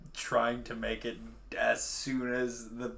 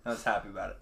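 normal talking voice

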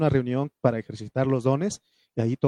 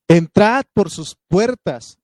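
high, loud and slow, declaiming; proclaimed verse; 5 semitones higher, 8 dB louder, slower than usual. Acted. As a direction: lift the verse up to the church. Quotes the psalm.

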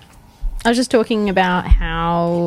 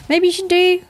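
high voiced inner voice; 11 semitones higher than usual. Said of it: "your body goes".

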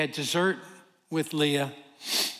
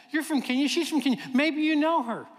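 eager naive blurt; 7 semitones higher than usual. Retold or imitated. Preacher to a Kenyan waitress, high eager blurt. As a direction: blurt it out, sure she knows. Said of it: "Why did you say that?".

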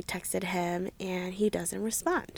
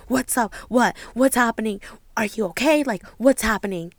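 louder, urgent string of questions; mock panic; 6 dB louder than usual. Him answering her serious phone call, alarmed. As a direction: imitate him panicking, firing worried questions. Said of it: "the script was reversed".

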